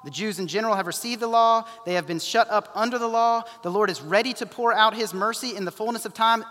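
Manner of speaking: normal talking voice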